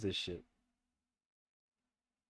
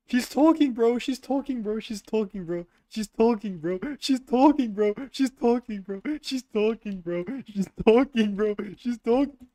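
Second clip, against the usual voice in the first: much higher, louder frantic repeating; panicked; 10 semitones higher, 6 dB louder than usual. Real, not acted. Gamer hears a ghost talking and panics to friends. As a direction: spiral into panic at the voice. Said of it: "I'm fucking scared".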